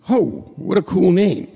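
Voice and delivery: ordinary talk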